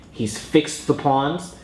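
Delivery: normal talking voice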